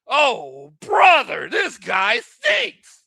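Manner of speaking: high, slow, loud groaning yell; drawn-out yell. Real, not acted. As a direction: stretch out the insult in despair. Disgust at the coach.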